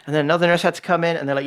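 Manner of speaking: usual speaking voice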